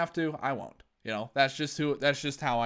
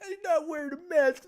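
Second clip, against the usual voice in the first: higher squeaky whine of the quote; mock her outrage, pitched up; high mock Karen whine; 12 semitones higher than usual. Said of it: "He's like".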